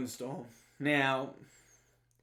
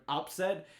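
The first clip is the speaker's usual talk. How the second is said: "upset" punched as the key word; stress on upset; 2 semitones higher than usual. Real, not acted. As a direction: hit the word "upset" firmly at the end. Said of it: "I do emphasize the word upset".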